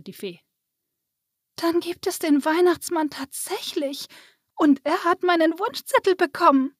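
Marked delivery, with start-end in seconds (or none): 1.6-6.7 put-on high, delighted little girl; light up with happy amazement, pitched high; beaming. A narrator's reading. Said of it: "Lisa strahlte". (strahlend vor Freude, hohe Kinderstimme)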